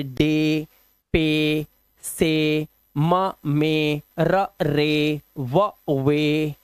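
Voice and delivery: डे, पे, से, मे, रे, वे with low, held "ay"; "ay" sound. Shown as a demonstration. Teacher sounding out examples, long "ay" vowels. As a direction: sound out slowly, stretching each ए. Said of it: "उच्चारण ए से करना है".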